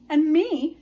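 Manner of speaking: high, loud eager chime. Acted. Otter piping up to be a friend, high and loud. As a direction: pipe up eagerly.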